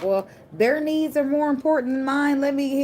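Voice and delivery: high, sustained mimicking voice; mock people-pleaser. Reader mimicking someone who puts others' needs first, high-pitched mock pleading. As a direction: put on a high-pitched self-sacrificing voice.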